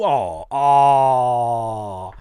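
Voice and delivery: long drawn-out "aww" groan; hammed-up wail of disappointment; mock dismay. Mock dismay at catching someone.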